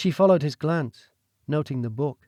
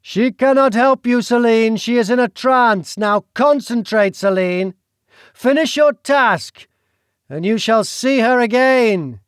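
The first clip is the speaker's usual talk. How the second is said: a menacing nobleman, pitched up and pressing hard; scheming duke; 8 semitones higher, 7 dB louder than usual. Acted. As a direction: lean in and push her to the deed. Duke urging again.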